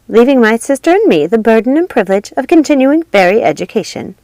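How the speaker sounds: stately fairy godmother; fairy godmother speech. A narrator's reading. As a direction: speak to the whole academy with ceremony. Fairy godmother addresses students.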